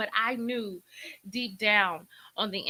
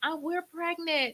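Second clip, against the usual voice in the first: high, drawn-out happy announcement; excited friend; 9 semitones higher, slower than usual. Retold or imitated. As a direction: imitate a thrilled friend, slow and high. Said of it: "she was so excited".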